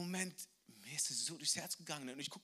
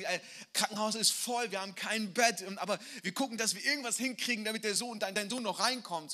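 higher, louder busy clinic voice; hurried staff telling him the ward is full; raised hospital staff voice; 7 semitones higher, 7 dB louder than usual. Retold or imitated. Retold hospital staff speaking.

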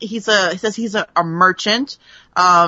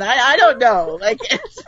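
usual speaking voice

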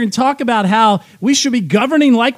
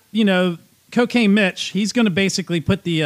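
normal talking voice

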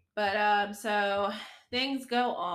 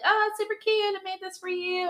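high, sing-song sweetness, much higher; sugary mock cute voice; 12 semitones higher, 5 dB louder than usual. Nanny presenting a snack to the child, high sugary voice. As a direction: coo it like an eager nanny.

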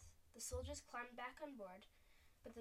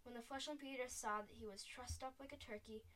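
usual speaking voice

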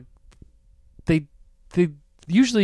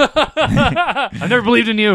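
mock mean dad; 6 semitones higher, slower than usual. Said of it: "being mean".

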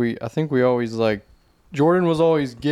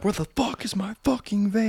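put-on frantic Gen Z kid; 9 semitones higher, 3 dB quieter than usual. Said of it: "they're like".

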